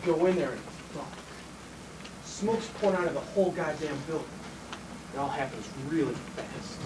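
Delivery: low, soft, reliving the fire; hushed recollection. Acted. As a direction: drop low and quiet as you remember. Reliving entering burning building.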